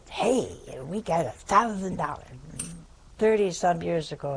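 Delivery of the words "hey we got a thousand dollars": excited announcement. Retold or imitated. Mother opening the mail announces the money, excited. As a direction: announce a windfall with delight. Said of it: "she said".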